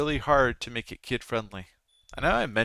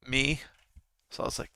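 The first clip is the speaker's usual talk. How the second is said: ordinary talk, nothing marked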